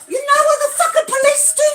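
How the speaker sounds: outraged question; indignant shout. Real, not acted. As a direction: challenge the listener. Outrage at police.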